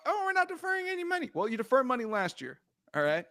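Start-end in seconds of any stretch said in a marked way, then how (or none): 0.1-1.3 mocking quote. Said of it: "talking that mess about".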